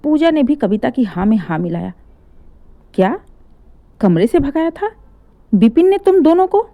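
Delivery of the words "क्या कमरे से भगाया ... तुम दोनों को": shocked. Acted. Father shocked.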